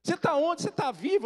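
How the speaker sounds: high, loud, imitated mother; frantic questioning. Mother on phone to lost son, frantic questioning. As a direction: fire off disbelieving questions.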